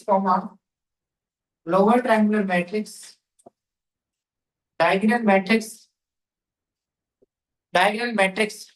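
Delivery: very slow, drawn-out words for note-taking; slow dictation. Real, not acted. Teacher dictating a matrix rule to students, slow dictation. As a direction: dictate phrase by phrase with long pauses.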